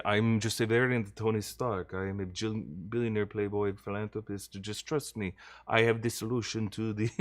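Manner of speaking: hushed mock-Russian movie villain; Downey, Russian accent. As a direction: put on a soft, amateur Russian accent. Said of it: "just doing a Russian accent".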